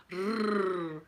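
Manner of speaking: "rrr" as a pure sustained trill; long trilled "rrr", no vowel; rolled R. Shown as a demonstration. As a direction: correct them with a tongue-tip rolled "rrr". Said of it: "And we're going".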